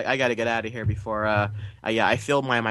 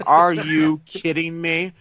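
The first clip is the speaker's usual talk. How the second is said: very slow, raised-pitch incredulous protest; retold outraged yell; 5 semitones higher, 3 dB louder, much slower than usual. Moviegoer re-enacting his yell at the credits, outraged. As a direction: re-enact the yell, stretching each word. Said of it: "I yelled out loud".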